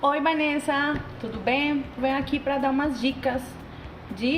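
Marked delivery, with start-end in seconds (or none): none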